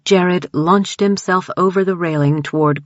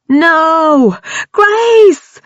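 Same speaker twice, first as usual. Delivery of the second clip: very high loud screaming name; anguished shriek; 12 semitones higher, 8 dB louder than usual. A narrator's reading. Brian watching Grace attack Dawn, anguished shriek. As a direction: shriek in horror. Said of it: "Brian shrieked".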